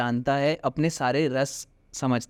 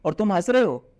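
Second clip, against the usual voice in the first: exasperated anger; 4 semitones higher than usual. Shown as a demonstration. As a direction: snap at him to stop laughing. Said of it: "मुझे गुस्सा आएगा".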